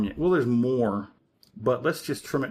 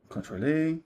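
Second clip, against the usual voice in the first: mock French accent; 4 semitones higher than usual. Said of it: "you have to do some stupid".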